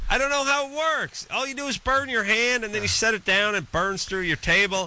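high-pitched comic bafflement; exasperated rant. Real, not acted. Host griping to co-host about burning bowls, high exasperated rant.

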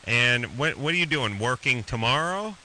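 ordinary delivery

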